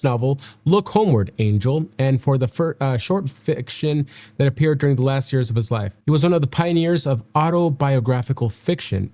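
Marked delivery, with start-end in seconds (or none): none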